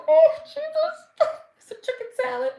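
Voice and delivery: overwhelmed cry of joy. Retold or imitated. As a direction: cry out to Jesus overcome with excitement. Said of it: "I said".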